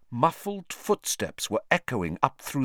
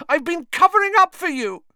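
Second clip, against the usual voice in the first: shrieking; 9 dB louder, faster than usual. Acted. Hermione continues shrieking.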